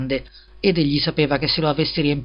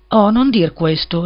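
slow, higher, louder plea; imploring; 6 semitones higher, 6 dB louder, slower than usual. A narrator's reading. Hans begs the offended miller, slow and imploring. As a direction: beg him not to say it, slowly. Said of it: "rimplorò il piccolo Hans".

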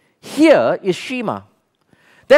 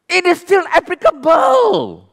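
emphatic shout; 11 semitones higher than usual. Real, not acted. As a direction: hammer the point home. Preacher's emphatic point.

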